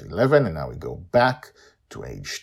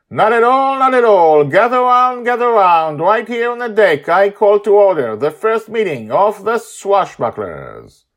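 proclaiming loudly; 12 dB louder than usual. Acted. Father loudly opens the meeting.

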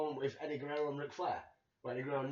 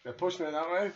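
normal talking voice

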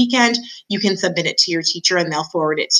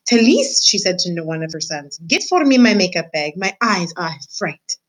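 vain French mother. Acted. French mother mouse orders her son for makeup, French-accented. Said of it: "She was a French mouse".

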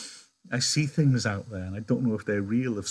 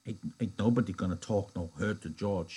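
grumbled; 2 semitones lower, 6 dB quieter than usual. Acted. Lenny grumbling to Crooks as he sits back down. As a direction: mutter it as you settle back. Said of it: "he grumbled".

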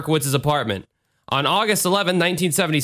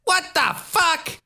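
high, slow, stretched squeal of disbelief; mock shocked echo; 13 semitones higher, much slower than usual. S0 echoing the outrage.

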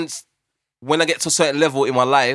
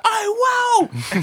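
much higher, shouted wow; amazed shout. Retold or imitated. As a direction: yell it out, amazed and way up high. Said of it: "There's like".

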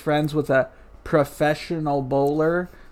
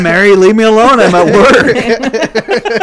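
loud, slow annoyed yell; exasperated shout; 7 semitones higher, 12 dB louder, slower than usual. Pretend. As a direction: yell it like you're fed up at work. Acting a guy harassed at work.

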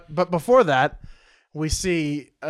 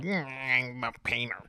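mock painter; 4 semitones lower, 13 dB quieter than usual. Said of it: "and she's like".

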